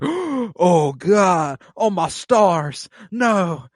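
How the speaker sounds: high, hammed-up shock; theatrical pearl-clutching, pitched high; mock scandalized audience. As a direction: gasp it out like a horrified onlooker. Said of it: "And the audience gasps".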